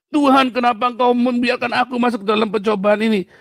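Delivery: high, loud anguished cry to God; desperate plea voiced high and loud; pleading prayer.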